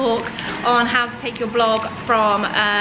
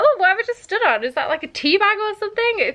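pitched-up retold "what was that" squeal; mock disgusted surprise; 8 semitones higher than usual. Retelling stepping in dog poo, higher mock surprise. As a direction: replay the moment of stepping in something squishy. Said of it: "I was like".

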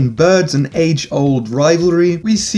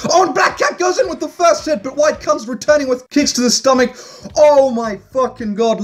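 excited fight commentary; 9 semitones higher than usual. Acted. Commentator narrating a cat fight, calls the hit excitedly. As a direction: pitch leaps way up, calling the big hit.

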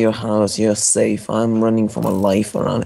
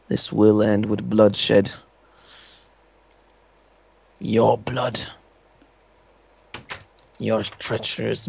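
sneering panto villain; 3 semitones lower, 6 dB quieter, slower than usual. Acted. Mock villain vow of revenge.

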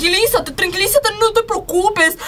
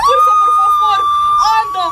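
urging shout; 4 dB louder than usual. Acted. Midwife urging push.

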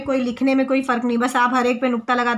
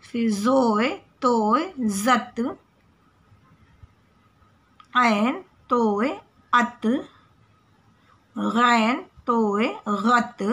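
slow letter drill; much slower than usual. Shown as a demonstration. Teacher drilling children on Urdu letters, slow clear recitation. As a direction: sound out each letter, then the joined syllable, with pauses between.

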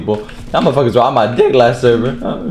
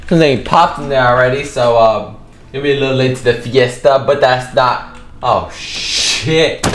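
ordinary delivery

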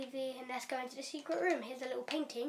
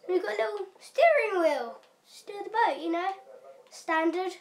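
higher and louder, showing off; excited; 6 semitones higher, 9 dB louder than usual. Real, not acted. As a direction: proudly show off the wheel. Showing off boat wheel.

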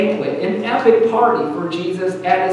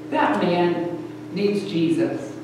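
softer, slower quoted aside; hushed parental judgement; 3 semitones lower, 8 dB quieter, slower than usual. Retold or imitated. Parents turning to their kids about the neighbour, hushed and slow. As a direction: say it low-key and slow, as a knowing aside.

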